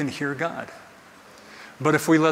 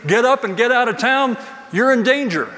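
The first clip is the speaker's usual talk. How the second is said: urgent warning; 7 semitones higher, 4 dB louder than usual. Retold or imitated. God's urgent warning, imagined.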